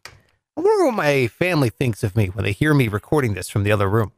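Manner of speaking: ordinary talk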